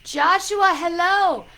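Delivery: loud, high-pitched hello; excited greeting call. Real, not acted. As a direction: call out to a friend who just arrived. Greets viewer arriving in the live.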